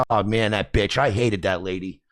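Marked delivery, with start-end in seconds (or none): none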